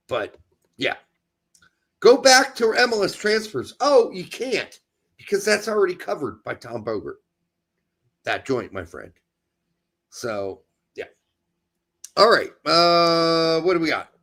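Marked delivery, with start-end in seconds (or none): none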